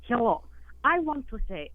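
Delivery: broken English in a put-on Russian accent; hammed-up Russian accent on a radio call; fake Russian accent. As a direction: play a Russian caller with a thick fake Russian accent. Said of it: "your fake accent".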